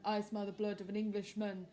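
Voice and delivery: storybook giant with changed timbre; giant threat. Acted. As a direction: menace like a giant. Giant chant again.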